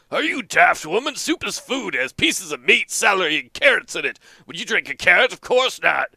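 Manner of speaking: put-on cranky old man ranting about spoons; grumpy old farmer. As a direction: grumble like an indignant old farmer. Earl argues with wife.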